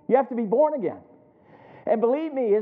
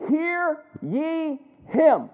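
very drawn out and higher, word by word; slow, emphatic command; 5 semitones higher, much slower than usual. Acted. Preacher delivers God's command to the congregation, slow and emphatic. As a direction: stretch each word into a solemn command.

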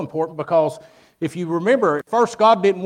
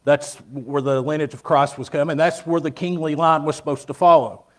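preaching; 6 dB louder than usual. Acted. Preaching to the congregation.

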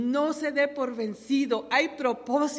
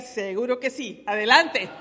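preaching at full force; 5 dB louder than usual. Acted. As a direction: lift the church with each line. Preacher voicing an eager believer. (predicación a todo pulmón, fuerte y pausada)

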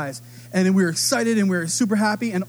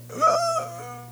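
a sudden high gasp of surprise; high sharp gasp. Retold or imitated. Dad to son's selfless wish, a high gasp. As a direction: gasp as if deeply moved. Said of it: "And I was like".

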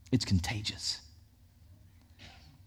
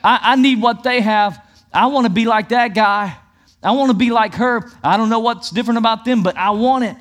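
loud, high, urgent wanting; eager longing; 6 semitones higher, 5 dB louder than usual. Acted. Pastor voicing an onlooker envying believers, loud and eager. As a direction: cry it out as someone desperate to have it. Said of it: "it causes somebody else to go".

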